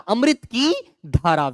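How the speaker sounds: अमृत की धारा said slowly with की leaned on; slow dictation. Shown as a demonstration. Teacher splitting the compound for the children, slow. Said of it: "इसको तोड़ दीजिए".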